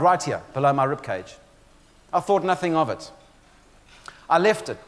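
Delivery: ordinary delivery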